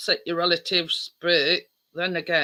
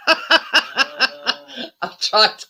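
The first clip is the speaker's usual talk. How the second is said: laughing; 7 semitones higher, 6 dB louder, much slower than usual. Real, not acted. Laughing at own joke.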